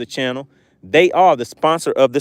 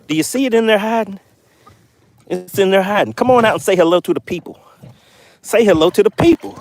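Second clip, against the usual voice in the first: coaxing; 5 semitones higher than usual. Pretend. Coaxing fish to say hello.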